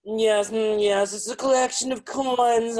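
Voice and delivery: high, loud, drawn-out put-on voice; mock-snooty collector. Guest plays along with hosts, high mock-snooty drawl. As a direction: ham it up as a pompous coin expert.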